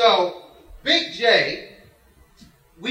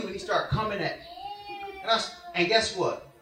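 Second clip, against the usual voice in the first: usual speaking voice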